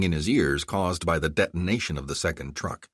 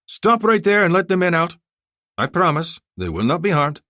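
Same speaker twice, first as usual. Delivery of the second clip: higher, louder order; commanding order; 11 semitones higher, 6 dB louder than usual. Acted. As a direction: halt the trucks firmly. Commander orders trucks to halt.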